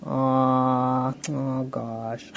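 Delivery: low, softening let-down sigh of words; mild disappointment. Acted. Child told no about staying up, mildly disappointed. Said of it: "now in the correct way".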